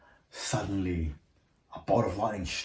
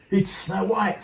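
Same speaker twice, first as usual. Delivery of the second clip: high, angry snarl from the queen; the jealous queen snarling at the mirror; 10 semitones higher, 4 dB louder, slower than usual. Acted. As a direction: spit the name out in jealousy. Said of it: "snarled the queen".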